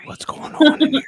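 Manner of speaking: playful hushed whisper; mock whisper. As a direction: whisper like in a library. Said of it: "Are we in a library?".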